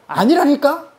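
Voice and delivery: high-pitched, insistent rebuttal; exasperated contradiction. Acted. John answers the stubborn washer, exasperated contradiction. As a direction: pitch up sharply in mock exasperation.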